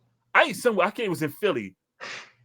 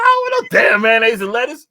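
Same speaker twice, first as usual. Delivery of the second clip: loud, high mock-furious yell; retold angry shout; 10 semitones higher, 12 dB louder than usual. Retelling a fight with a cashier, loud mock yell. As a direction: shout it like you're fed up at the counter. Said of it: "I'm like".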